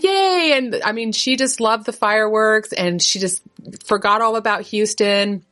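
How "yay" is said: put-on child's cheer.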